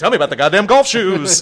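high, loud teasing call-out; loud joking shout. Acted. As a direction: belt it out as a joke. Joking shout amid laughter.

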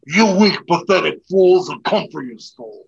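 loud, menacing villain taunt. Retold or imitated. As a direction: sneer it like a movie villain. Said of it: "That's just from the movie".

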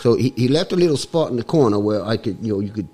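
comic mock-Cockney accent; put-on Cockney accent. Guest explains his voice to the host, put-on Cockney accent. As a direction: talk in a hammed-up Cockney accent. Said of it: "it's a little bit Cockney".

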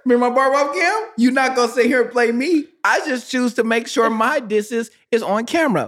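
playful protest. Real, not acted.

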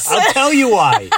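high, laughing promise. Real, not acted. To his daughter, laughing high about leather pants. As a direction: squeal it through a laugh.

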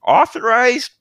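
very high, prissy nurse voice; sweetly block the way, pitched high; prim nurse. Acted. GM voicing prim nurse NPC.

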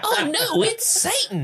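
very high squeaky gasp; mock alarm. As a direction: squeal it like a spooked cartoon.

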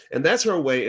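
normal talking voice

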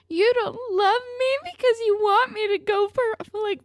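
high, put-on whiny girlfriend voice; exaggerated petulant parody; mocking whine. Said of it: "so crazy and stupid to me".

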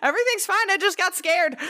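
very high, near-tearful squeal; panicked squeal. Real, not acted. Friend explaining she got scared, squealing high. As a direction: squeak out a flustered reassurance.

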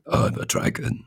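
raspy, voiceless dragon voice; generic dragon. Acted. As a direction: rasp it out like a dragon. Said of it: "I really like that dragon voice".

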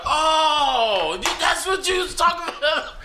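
loud, high-pitched "ohh, now I get it"; mock sudden realization. Coworkers finally getting what the cook meant, exaggerated realization. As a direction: imitate them finally catching on, voice shooting up.

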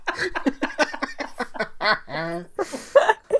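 high, long crying laugh; real laughter close to tears; helpless laughter. Real, not acted. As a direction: dissolve into tearful laughter. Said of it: "I'm crying".

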